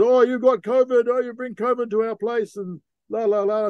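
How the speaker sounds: lightly voiced old man scolding; accusing local. Older local accuses the crew of bringing covid, high mock scolding.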